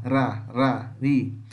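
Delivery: isolated ra, ra, ri with tapped R; tapped R syllables. Shown as a demonstration. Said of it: "Esse é o som do R".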